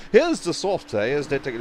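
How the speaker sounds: put-on slow, lightly done British accent of a TV analyst; a hint of a British pundit, drawn out; terrible British accent. Quoting the F1 commentator in a slow, hammy British accent. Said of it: "that was just my terrible accent".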